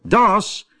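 high-pitched call at the door. A narrator's reading. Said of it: "riep Waterrad". (hoog roepend aan de deur)

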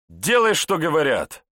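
loud, high, exasperated order; irritated bark. A narrator's reading. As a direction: bark it out, out of all patience. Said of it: "рявкнул следователь, показывая крайнюю степень раздражения".

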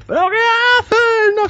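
high-pitched indignant retort from the guy who turns round; angry arcade guy. Retold or imitated. Big arcade guy turning on the kid, high indignant squeal. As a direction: squeal it out, caught off guard and annoyed. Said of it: "se gira el tío".

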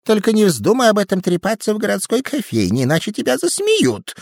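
высокий женский голос, советуя; мягко предостеречь; голос Асушан. A narrator's reading. (женский голос)

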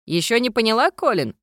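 surprised, pitch jumping up. A narrator's reading. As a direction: ghost amazed she hasn't noticed. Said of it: "удивился призрак".

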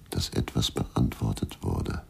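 fades to a whisper. Acted. Berger, after finding Isabel dead, whispering the end. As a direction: let the thought die away in a breath. Grieving man trailing off.

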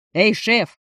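loud bark over street noise; barked into phone. Acted. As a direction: snap it loudly into the phone. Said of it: "рявкнул Ленчик в трубку".

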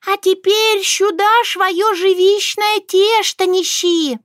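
high, squeaky commanding voice; bossy little mouse. A narrator's reading. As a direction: order her about like a tiny boss. Murinka orders again.

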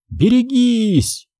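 high, drawn-out warning cry; warning shout. Acted. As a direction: yell a warning. (предостерегающий крик)

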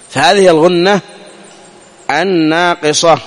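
ordinary talk, nothing marked

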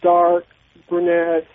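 ordinary delivery

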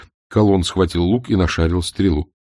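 ordinary delivery

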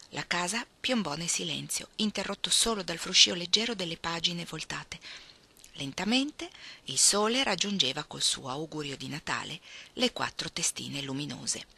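ordinary delivery, nothing marked

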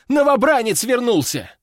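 high-pitched alarmed squeal; excited young soldier, pitch way up. A narrator's reading. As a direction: burst in squealing with urgent news. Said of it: "заверещал паренёк".

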